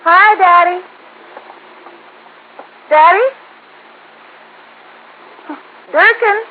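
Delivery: high, loud calls into empty rooms; calling through the house. Acted. Daughter calls through the house for her father, loud rising calls.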